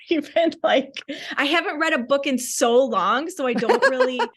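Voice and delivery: laughing through the words. Real, not acted. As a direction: giggle while confessing.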